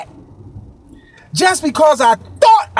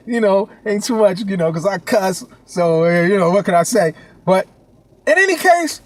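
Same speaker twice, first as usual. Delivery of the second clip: ordinary talk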